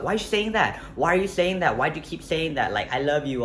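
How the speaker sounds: high-pitched upset pleading, easing toward the end; upset protest. Retold or imitated. Girlfriend protesting to boyfriend's fling remark, upset and high. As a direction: imitate an upset, insistent girlfriend. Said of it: "she got so upset".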